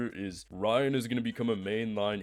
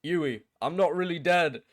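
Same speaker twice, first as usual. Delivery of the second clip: badly faked Butcher accent, jumping high and loud at the end; 5 semitones higher, 6 dB louder than usual. Butcher reveals to Huey he faked his death, bad accent. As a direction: attempt a hammy Butcher accent. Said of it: "I'm so bad at these, bro".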